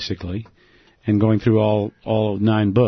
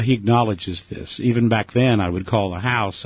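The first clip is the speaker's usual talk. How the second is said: usual speaking voice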